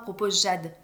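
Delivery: ordinary delivery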